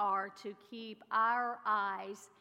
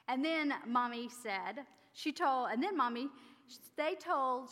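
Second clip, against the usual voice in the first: usual speaking voice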